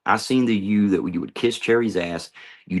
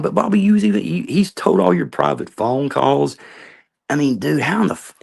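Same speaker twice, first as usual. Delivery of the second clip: much higher, louder, near shouting at Bobby; angry outburst; 7 semitones higher, 4 dB louder than usual. Real, not acted. Ranting at Bobby over leaked private calls, angry outburst. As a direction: blow up in disbelief.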